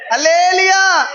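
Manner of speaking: high loud praise call; preacher's loud hallelujah; shouted hallelujah. Real, not acted. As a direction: lift the church with it.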